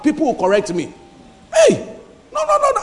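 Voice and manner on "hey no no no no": much higher, sharp cry of "no"; alarmed objection. Pretend. Friend correcting him when he goes wrong, high alarmed no. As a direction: jump in to stop someone going wrong.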